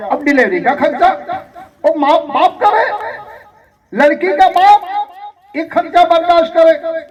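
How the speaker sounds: scandalised sermon delivery; indignant preaching. Acted. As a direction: preach it as an incredulous protest. Preaches against expenses on bride's father.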